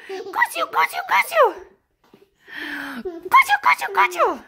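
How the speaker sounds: very high gleeful got you; playful squeal. Real, not acted. Mother catching and tickling her toddler, very high and playful.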